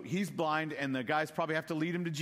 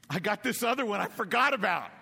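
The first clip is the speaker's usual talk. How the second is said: a louder, higher burst of mock surprise; sudden remembering; 7 semitones higher, 6 dB louder than usual. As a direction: play it as Jesse suddenly remembering his forgotten son. Jesse suddenly remembers another son.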